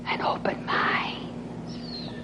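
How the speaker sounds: slow, whispered plea; hushed appeal. Real, not acted. Preacher asks the congregation to open up, hushed whisper. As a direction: whisper it slowly, drawing out each word.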